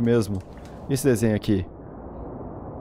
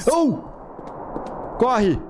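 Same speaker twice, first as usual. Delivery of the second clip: panicked shout; 12 semitones higher than usual. Real, not acted. Fleeing the knife killer.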